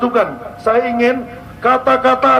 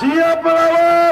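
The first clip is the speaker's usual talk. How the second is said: rally call; 5 semitones higher, slower than usual. Real, not acted. Rally leader rouses the crowd.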